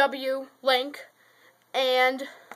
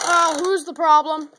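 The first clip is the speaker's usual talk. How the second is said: excited call; 4 semitones higher, 6 dB louder than usual. Real, not acted. Toy race going wrong.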